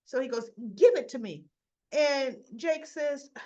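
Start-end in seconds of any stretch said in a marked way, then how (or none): 0.8-1.4 loud, forceful retold order; imitating a demanding younger brother; sharp demand. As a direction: demand it back abruptly. Boy demands his vape back.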